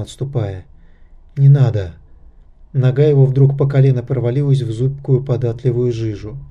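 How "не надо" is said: plain reading voice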